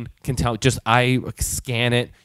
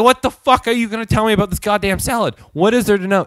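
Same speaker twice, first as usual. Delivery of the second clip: raised, high-pitched mock outrage; indignant retort; 7 semitones higher, 4 dB louder, faster than usual. Customer retelling his comeback to friend and cashier, indignantly. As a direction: fire back in exasperated disbelief. Acted angry retort about the salad.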